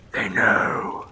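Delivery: low, soft ominous whisper; hushed whisper. Acted. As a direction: whisper it like a creepy secret. Repeats line in a whisper.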